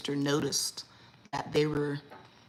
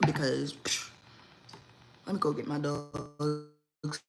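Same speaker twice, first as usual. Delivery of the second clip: usual speaking voice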